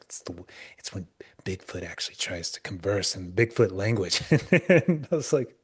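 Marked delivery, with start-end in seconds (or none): 0.0-4.2 hushed, breathless whisper; retold campfire whisper of the Bigfoot tale; spooky whisper. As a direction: whisper it like a ghost story told in the dark. Retelling the Bigfoot caller's account.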